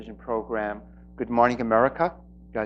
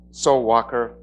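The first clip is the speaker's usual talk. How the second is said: ordinary delivery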